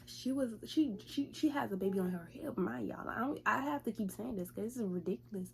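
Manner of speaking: usual speaking voice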